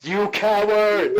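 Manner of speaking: loud, drawn-out, high-pitched taunt; accusing shout. Acted. Player to fleeing Barry in character, loud accusing yell. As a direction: yell it slowly across the yard. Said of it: "I want to say to Barry".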